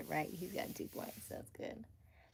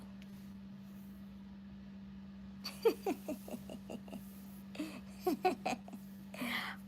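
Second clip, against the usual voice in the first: long laugh; 10 semitones higher, 5 dB quieter than usual. Real, not acted. Laughing at chat message.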